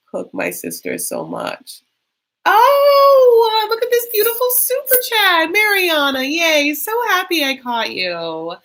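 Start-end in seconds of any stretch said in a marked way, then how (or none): 2.4-8.5 high, gushing singsong greeting; delighted squeal. Real, not acted. Host gushing over a viewer's super chat, high-pitched delight. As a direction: squeal with delight, drawing out the words.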